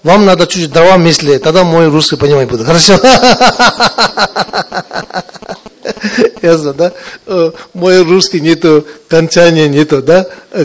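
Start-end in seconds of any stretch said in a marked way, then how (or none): 3.0-5.2 laughing. Real, not acted. Teacher laughs after joke.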